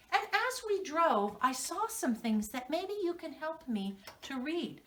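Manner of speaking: normal talking voice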